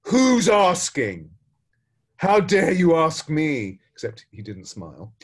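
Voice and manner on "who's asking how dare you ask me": put-on loud, slow, offended old gardener voice; indignant retort. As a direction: bristle at the insult and throw it back. Gardener snaps back at samurai.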